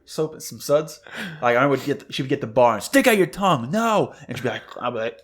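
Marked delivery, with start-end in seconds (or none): 2.8-4.2 high-pitched mock parental command; scolding parent. Mom with the soap bar to her kid, high-pitched scolding.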